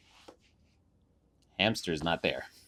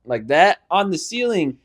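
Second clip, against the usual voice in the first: ordinary talk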